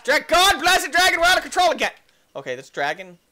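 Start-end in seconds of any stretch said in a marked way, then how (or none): 0.1-1.9 high, loud frustrated yell; frantic scolding yell; exasperated shout. Real, not acted. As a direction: shout in alarm at losing control. Dragon out of control.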